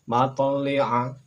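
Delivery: Matali'a very slow, t bouncing; slow Arabic demo. Shown as a demonstration. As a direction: model Matali'a slowly and loud. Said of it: "Berikutnya silakan".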